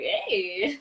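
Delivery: a hint of a seductive greeting; sexy voice. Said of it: "Now that I'm sexy voice".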